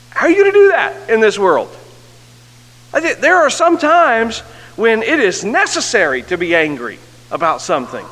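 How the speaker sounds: rally the congregation with rising fervor; impassioned preaching. Acted. Preacher urging the church on anger at sin, impassioned preaching.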